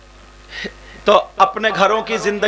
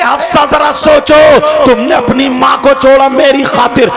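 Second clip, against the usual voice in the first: loud, driving sermon cadence; preaching at full force; 8 semitones higher, 10 dB louder than usual. Acted. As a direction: hammer the story home to the crowd. (पूरे जोश से was)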